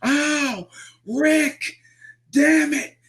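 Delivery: very high, drawn-out howls; imitated screaming in pain. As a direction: scream through a leg cramp, hammed up as mimicry. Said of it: "he's screaming at the top".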